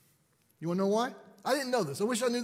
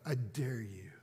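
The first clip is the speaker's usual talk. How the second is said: low, hissed dare; menacing whisper of a sulky teen; unvoiced, low threat; defiant whisper; 8 semitones lower, 11 dB quieter than usual. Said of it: "This is how she looked".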